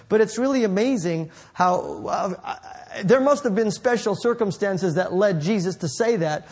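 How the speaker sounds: ordinary delivery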